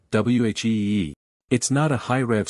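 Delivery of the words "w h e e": stretched-out joyful wheee; gleeful wheee. Retold or imitated. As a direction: shout wheee like the bike takes off. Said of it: "a power band that's like".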